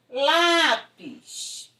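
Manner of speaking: Lápis with a strong, held first syllable; stress on penultimate syllable. Shown as a demonstration. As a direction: stretch and push the LÁ of Lápis. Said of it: "quando a sílaba tônica for a penúltima".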